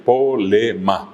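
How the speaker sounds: slow po-le-ma, no R or B; polema silabeado. Retold or imitated. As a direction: draw out polema syllable by syllable. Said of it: "le escuché bien". (polema, lento, sílaba por sílaba)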